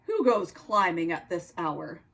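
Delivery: put-on low-pitched Russian-accented nutcracker; deep Russian accent, a sentry's challenge. Nutcracker questions Oscar on the tree, low Russian accent. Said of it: "spoke in a Russian accent".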